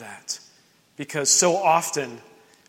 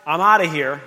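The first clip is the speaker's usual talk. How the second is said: loud, high, brisk mock eagerness; eager quick yes; 6 semitones higher, 9 dB louder than usual. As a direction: toss it off like you're already out the door. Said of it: "jump up and be like".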